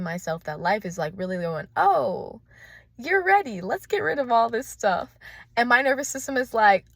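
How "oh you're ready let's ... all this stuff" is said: high, loud mock-eager voice; high excited voice. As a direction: play life as a giddy cheerleader.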